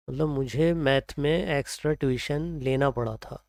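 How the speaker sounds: ordinary talk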